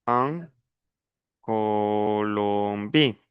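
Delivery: very slow, drawn-out "Colombie"; slow dictation. Shown as a demonstration. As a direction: stretch each syllable of "Colombie" for the students. Teacher dictates the answer slowly. ("Colombie" muy lento, sílaba por sílaba)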